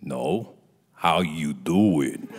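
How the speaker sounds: very slow, low, pointed question; mock director seeing through the bluff; Rob Reiner. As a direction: stretch it out deep and knowing. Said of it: "Like that".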